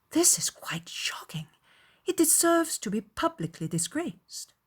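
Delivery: indignant on a friend's behalf; shocked indignation. A narrator's reading. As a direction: react with shocked, emphatic disapproval. Elizabeth shocked at hearing the wrong.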